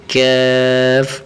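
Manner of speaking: کیف stretched but kept thin and light; thin drawn-out کیف; light drawn keef. Shown as a demonstration. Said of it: "تھوڑا سا کھینچیں گے لیکن باریک کر کے".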